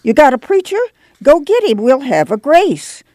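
put-on high, shrill old grandma; piercing old woman's voice. A narrator's reading. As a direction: pipe up shrilly and demand it. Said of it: "Grandma raised a shrill voice".

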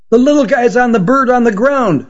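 put-on high, shouted alert from a boy; boy shouting. A narrator's reading. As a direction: yell it out, pitched far up like a kid. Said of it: "shouted the boy in the red baseball cap".

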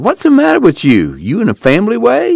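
high, loud suspicious demand; startled demand. Acted. Al demanding of the girl why she's upset, loud and high. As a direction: fire the question back at her.

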